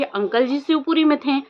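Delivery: normal talking voice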